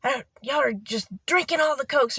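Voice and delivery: high, loud mock yell about the Cokes; imitated screaming. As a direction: shout it like the ranting boss. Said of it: "she started screaming and yelling".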